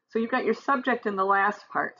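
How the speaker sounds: ordinary delivery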